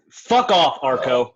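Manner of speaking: high, loud angry snap; angry outburst. Acted. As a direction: lash out at him, loud and high. Insulted by Arco's speech.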